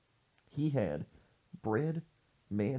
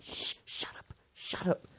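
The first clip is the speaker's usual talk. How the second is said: urgent whispered shush; frantic hushed warning while hiding. Retold or imitated. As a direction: hiss it so nobody hears. Hissing at friend while hiding.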